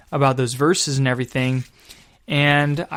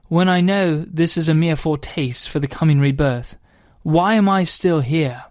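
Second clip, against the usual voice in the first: a mild put-on British accent for reading a poem; 2 semitones higher than usual. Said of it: "I'm gonna do this in an accent because I think it just sounds cooler".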